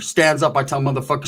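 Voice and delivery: ordinary talk, nothing marked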